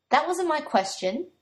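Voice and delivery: slow, playful scolding; teasing reprimand. A narrator's reading. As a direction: tease him while telling him off. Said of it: "in teasing reprimand".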